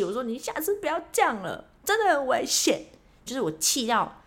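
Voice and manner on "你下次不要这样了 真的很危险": higher-pitched stern warning; a touch of higher, firm telling-off; mild scolding. Retold or imitated. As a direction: tell the driver off, not too harshly. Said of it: "但是我就还是很气".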